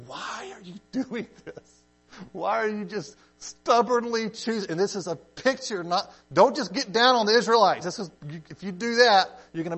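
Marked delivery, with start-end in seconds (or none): 0.1-4.7 incredulous pleading. Acted. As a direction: plead in disbelief, pitched way up. Acted pleading with stubborn people.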